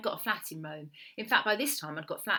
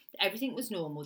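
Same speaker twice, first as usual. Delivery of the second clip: plain reading voice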